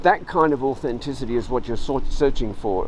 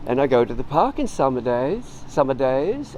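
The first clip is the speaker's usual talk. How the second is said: DAYS lifted in a rise at the end; high-rising terminal. Shown as a demonstration. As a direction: let "days" climb as if asking a question. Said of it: "a high-rising terminal at the end".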